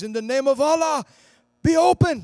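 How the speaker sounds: high, loud healing command; commanding shout. Retold or imitated. Evangelist commanding deaf ears to open, shouting. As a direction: shout the command over the girl.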